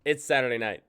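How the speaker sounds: loud, high show-opening hello; loud greeting. Real, not acted. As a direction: call out to the audience. Show host opening call.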